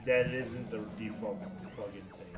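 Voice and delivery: ordinary talk, nothing marked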